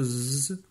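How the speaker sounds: "z" as a long voiced buzz; sustained "z", not the letter name; timid letter sound. Shown as a demonstration. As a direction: hold the buzzing sound of "z". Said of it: "donc il chante".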